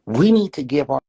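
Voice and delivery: normal talking voice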